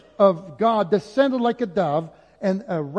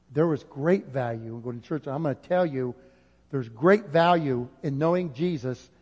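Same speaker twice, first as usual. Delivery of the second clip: usual speaking voice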